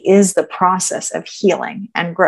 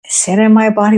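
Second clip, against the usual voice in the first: ordinary talk, nothing marked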